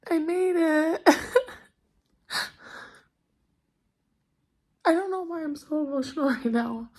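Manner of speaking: real tearful breakdown; choked up and trying to hold together; crying. Real, not acted. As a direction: talk through tears with sighs and long pauses. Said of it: "enjoy seeing me cry".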